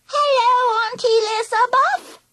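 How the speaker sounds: put-on high warbling toddler voice; babyish, sing-song squeak with a lisp; lisping three-year-old. A narrator's reading. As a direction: warble it like a lisping little child. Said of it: "lisping like a three-year-old".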